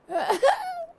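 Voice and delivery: high delighted laugh; bright laugh breaking out; burst of laughter. Real, not acted. Laughing at the story.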